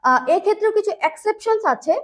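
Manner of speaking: ordinary delivery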